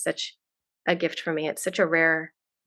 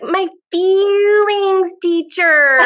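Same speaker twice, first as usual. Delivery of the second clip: put-on much higher, louder, very slow child voice, laughing through it; little daughter; 12 semitones higher, 8 dB louder, much slower than usual. Mom imitating her daughter's sweet answer for the hosts, higher, slow, laughing child voice. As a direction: stretch it out like a delighted little girl, laughing.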